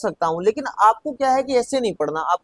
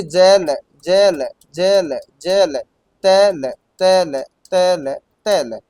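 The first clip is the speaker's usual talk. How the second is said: slow, drawn-out repeated words; slow typing drill; 4 semitones lower, much slower than usual. Shown as a demonstration. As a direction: stretch each word as you type it. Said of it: "वो, वो कर लो".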